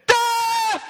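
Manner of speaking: very high, loud war shout; rally the cavalry with one cry; battle cry. Retold or imitated. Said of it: "And he goes".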